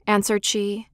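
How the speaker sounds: ordinary delivery, nothing marked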